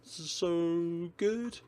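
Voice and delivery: high, slow blissful moan of pleasure; savouring delight.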